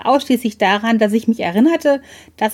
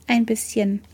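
quiet; 5 dB quieter than usual. A narrator's reading. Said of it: "erwiderte ich leise".

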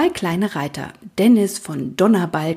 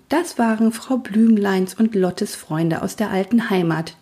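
usual speaking voice

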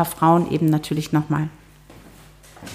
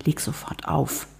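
urgent whisper, slowed; whispered order; 2 semitones lower, 8 dB quieter than usual. Acted. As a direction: hiss it under your breath. Hissed order while hiding.

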